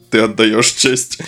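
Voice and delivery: ordinary delivery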